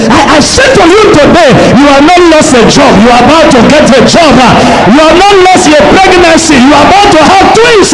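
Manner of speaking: fast, high, mounting declaration; full-force preaching. Real, not acted. Preacher promising listeners life, jobs and twins, full-force preaching. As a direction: fire off each promise, climbing in pitch.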